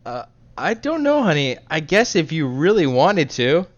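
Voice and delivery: high-pitched, reluctant giving-in; hesitant partner. Acted. Partner reluctantly answers honey's bedroom request, hesitant and high.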